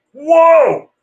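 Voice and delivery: hammed-up amazed dad to his son; mock excited. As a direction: gush over the toy like it's the coolest thing. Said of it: "and I'm like".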